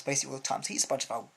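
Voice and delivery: ordinary delivery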